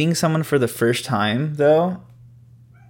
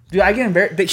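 normal talking voice

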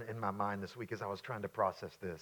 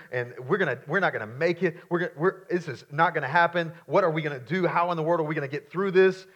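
loud, agitated mock alarm; acted panic; 7 semitones higher, 9 dB louder than usual. Disciples waking Jesus as the boat sinks, in acted panic. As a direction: play frantic protest, pitched up and pressing.

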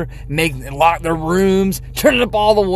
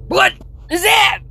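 surprised shout. Real, not acted. Sudden loud noise reaction.

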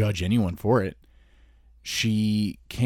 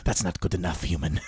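low, softer cat character; talking cat; 5 semitones lower, 5 dB quieter than usual. Acted. Cat answering its human back, low unimpressed cat voice. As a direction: dismiss the owner in a lightly put-on low cat voice. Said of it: "And she's like".

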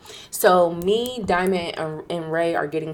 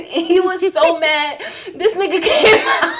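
high, loud, laughing through the words; 12 semitones higher, 9 dB louder than usual. Real, not acted. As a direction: crack up while telling the story. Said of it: "I can laugh now".

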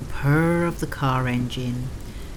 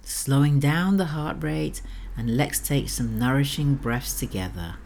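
sultry narrator. Acted. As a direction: lull the listener toward sleep. Sleep story host voice.

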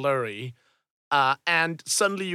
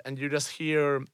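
ordinary delivery

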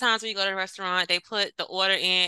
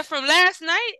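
higher, louder indignant question; mock outrage; 8 semitones higher, 5 dB louder, slower than usual. Retelling boyfriend's tossing the egg rolls, indignant. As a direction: throw it back in disbelief. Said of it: "I said".

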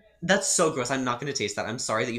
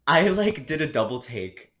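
ordinary delivery

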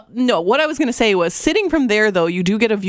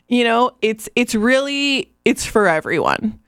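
usual speaking voice

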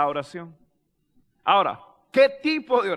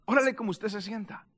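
softer, earnest restatement; softened repeat; 3 semitones lower, 7 dB quieter than usual. Real, not acted. Preacher urging congregation to pray honestly, softened repeat. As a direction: say it quietly to let it sink in. Exhortation repeated more softly.

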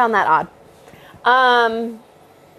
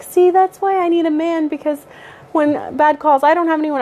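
higher, self-pitying coworker; coworker's lament; 7 semitones higher than usual. Retold or imitated. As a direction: whine it up high like the lonely coworker. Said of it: "one of the girls ... was like".

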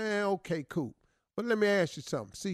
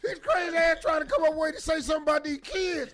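crazy man voice; 8 semitones higher than usual. Pretend.

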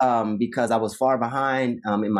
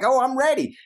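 high-pitched, eager self-quote; naive eagerness, retold; 13 semitones higher than usual. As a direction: play your keen younger self, voice lifted high. Eager newcomer expecting easy classes.

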